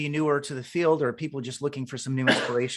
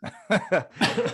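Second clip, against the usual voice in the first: short bright real laugh; 6 semitones higher than usual. Real, not acted. Host laughing at guest's top-million joke, real laugh.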